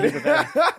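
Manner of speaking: high, laughing repeat of the praise; laughing echo. Acted. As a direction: echo it back while cracking up. Echoed back laughing and high.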